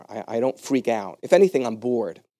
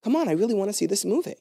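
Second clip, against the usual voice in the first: quick, higher pleading coax; pleading friend; 8 semitones higher, faster than usual. Retold or imitated. Friend begging to go back into the movie, quick high pleading.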